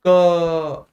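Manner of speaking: "क" held long on its vowel; uzatılmış hece. Shown as a demonstration. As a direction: stretch the vowel of "क" out. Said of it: "लंबे समय तक बोलो".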